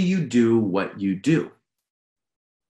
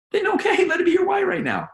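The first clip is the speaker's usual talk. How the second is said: much higher, impassioned coaching cry; emphatic shout. Real, not acted. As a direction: urge them on in a high-pitched shout. Emphatic reply to imagined self-doubt.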